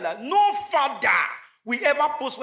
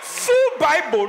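slow emphasis; 3 semitones higher, 5 dB louder, much slower than usual. Real, not acted. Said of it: "comic emphasis".

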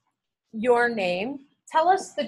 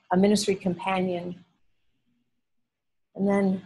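spoken prayer; 5 semitones lower than usual. Acted. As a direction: pray it gently over the class. Opening class prayer.

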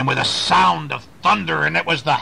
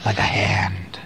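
hushed, breathy whisper; spooky whisper; 6 semitones lower than usual. Acted. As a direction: whisper it to give a shiver. Spooky tree limb shown.

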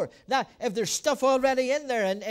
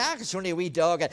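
lower, fast dismissive voice; light wife voice; 6 semitones lower, faster than usual. Retold or imitated. Wife Laura unbothered by the dog, lightly voiced. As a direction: brush it off lightly. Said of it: "She said".

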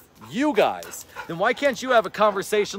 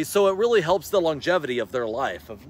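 usual speaking voice

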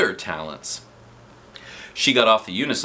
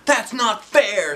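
mock shout; 12 semitones higher, 3 dB louder, much slower than usual. A narrator's reading. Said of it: "somebody shouted".

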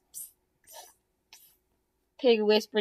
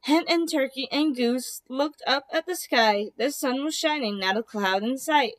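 ordinary delivery, nothing marked

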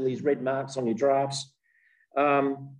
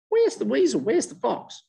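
higher, wondering calls; a much higher, curious rabbit; puzzled rabbit; 9 semitones higher than usual. Acted. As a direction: look around and wonder.

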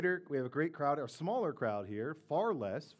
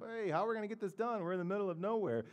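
higher, quick voice of bewildered disciples; disciples asking; 6 semitones higher, faster than usual. Retold or imitated. Disciples ask Jesus how they will feed the crowd, bewildered. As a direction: rush it out as a worried question. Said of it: "and yet they ask him".

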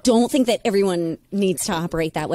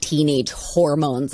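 normal talking voice

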